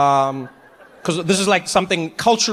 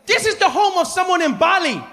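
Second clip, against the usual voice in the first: much higher, shouting guide welcome; overeager tour guide; 11 semitones higher than usual. Retold or imitated. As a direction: greet them like a way too happy guide. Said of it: "he's like".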